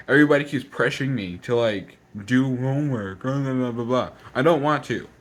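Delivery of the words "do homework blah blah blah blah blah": mocking nagging. Student mimicking people pressuring them about homework, mocking. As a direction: drag it out like tiresome nagging.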